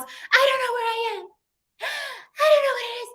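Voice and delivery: hysterical panic. Retold or imitated. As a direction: play her lost and frantic on the phone. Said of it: "She is hysterical".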